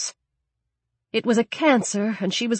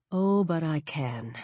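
cold quiet menace; 4 semitones lower, 9 dB quieter than usual. Acted. Cold reply to pleading enemy.

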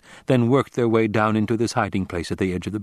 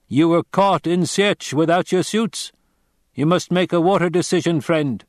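a grave stranger giving a warning; Fremen warrior; 8 semitones higher, 4 dB louder than usual. Acted. Narrator voices the Fremen.

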